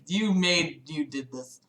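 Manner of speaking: normal talking voice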